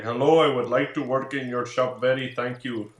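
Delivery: a mild Indian accent impression. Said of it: "an Indian voice".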